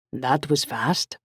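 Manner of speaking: put-on slow, Italian-accented delivery; Italian-accented friend picking up the phone; rich Italian accent. A narrator's reading. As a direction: answer unhurriedly in an Italian accent. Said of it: "answered Raf in his rich Italian accent".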